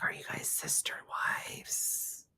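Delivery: slow nosy whisper; whispered gossip. Retold or imitated. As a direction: lean in and whisper it slowly. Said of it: "I'd be like".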